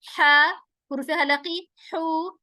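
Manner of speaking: normal talking voice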